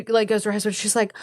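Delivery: ordinary talk, nothing marked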